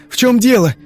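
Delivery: high, loud urgent question; alarmed. Acted. Father startled by daughter's scream, alarmed. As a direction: burst out in alarm. Father demands what happened.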